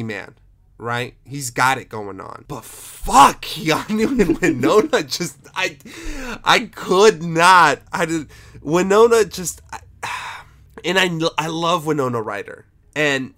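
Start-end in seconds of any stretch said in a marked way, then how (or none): 2.5-12.6 loud, higher exasperated outburst; real exasperation. Real, not acted. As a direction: lose patience, too worked up to finish. Venting exasperation at two miscast actors.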